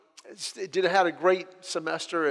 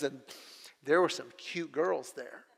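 quieter, lower retelling of mom's hint; coy mom; 4 semitones lower, 8 dB quieter than usual. Retold or imitated. Mom hinting to her single son about cute girls, coy and quiet. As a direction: drop it in softly, like a sly suggestion.

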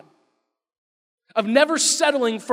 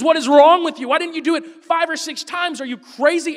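loud, higher, enraged scolding; furious rebuke; 7 semitones higher, 6 dB louder than usual. Retold or imitated. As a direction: blow up at the king. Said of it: "Elisha loses it".

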